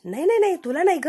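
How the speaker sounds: high, hurried no no no; flustered denial. Retold or imitated. Caught husband hastily denying to his wife. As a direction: backpedal in a panic.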